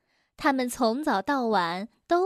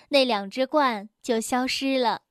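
ordinary delivery, nothing marked

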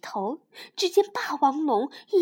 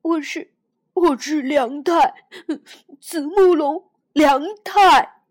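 loud, building declaration; a little dinosaur crying out who he is; defiant tearful shout; 2 semitones higher, 12 dB louder than usual. Acted. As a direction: shout his name through tears. Hero shouts identity in tears.

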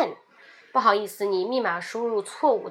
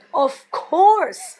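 of course much higher and louder; insulted duh; 6 semitones higher, 7 dB louder than usual. Shown as a demonstration. Offended at a dumb question, loud of course. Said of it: "我感觉你有点在低估我的智商".

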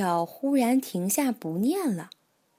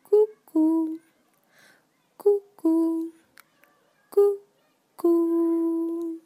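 cuckoo clock call; 6 semitones higher, slower than usual. Acted.

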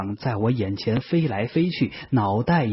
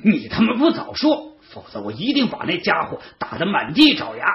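high-pitched furious outburst; angry roar; 5 semitones higher, faster than usual. A narrator's reading. 子时 enraged on learning the truth, roaring high. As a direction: explode high and indignant, pushing each phrase. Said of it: "高声吼道".

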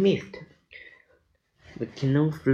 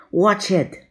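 high, loud storybook shout; shouted warning; 7 semitones higher, 6 dB louder than usual. A narrator's reading. Jack sees fruit hurled at the canoe, shouting. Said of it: "shouted Jack".

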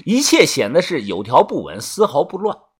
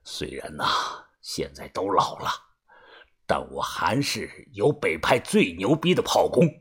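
much lower, hushed menacing elder; vengeful old man; 7 semitones lower, 6 dB quieter than usual. A narrator's reading. Old boss vows to kill Song Laosi, low menacing voice. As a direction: boast low and swear revenge.

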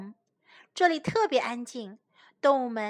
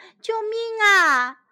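shouting for help; 7 semitones higher, 5 dB louder than usual. A narrator's reading. Wild rabbits call for rescue from developers, shouting. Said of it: "野兔们喊着".

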